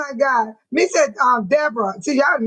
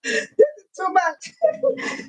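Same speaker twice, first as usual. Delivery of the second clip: high, slow, laugh-cracked; dragged out through crying laughter; overwhelmed by laughter; laughing to tears; 7 semitones higher, much slower than usual. Real, not acted. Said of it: "I am so serious".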